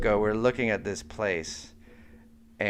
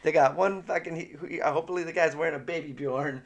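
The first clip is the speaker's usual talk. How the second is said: mock excitement; 7 semitones higher than usual. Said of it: "That's what he was thinking".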